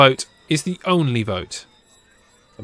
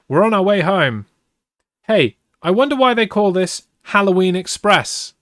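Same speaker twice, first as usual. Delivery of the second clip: high, loud, upbeat character voice; lively and raised in pitch and volume; cheering; 7 semitones higher, 5 dB louder than usual. A narrator's reading. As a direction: read the boy's lines with cheerful excitement. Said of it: "Brad cheers".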